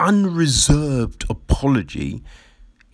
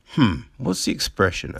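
ordinary talk

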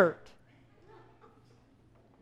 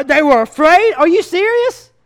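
incredulous; 3 semitones higher, 4 dB louder than usual. Real, not acted. Pastor reacting to scripture, loud disbelief. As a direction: sell the surprise to the church. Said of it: "mock disbelief".

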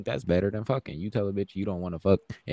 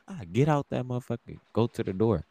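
usual speaking voice

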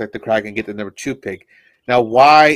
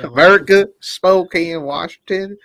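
"America," called out higher and louder, hammed-up; dumb American; 6 semitones higher, 4 dB louder, slower than usual. Friend mocking how Americans sound abroad, loud mock pride. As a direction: shout "America," like a proud yokel. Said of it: "that's what y'all sound like?".

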